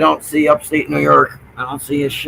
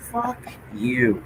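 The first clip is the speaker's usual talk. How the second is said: very soft, under the breath; hushed swearing; 2 semitones lower, 13 dB quieter than usual. Real, not acted. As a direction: keep the curse barely audible. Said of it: "I can say this now".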